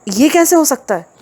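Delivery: high, startled and flustered question; a frightened woman's disbelieving cry; alarmed gasp. A narrator's reading. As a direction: she blurts it out in a panic. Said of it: "इसी घबराहट में उसके मुंह से निकला था".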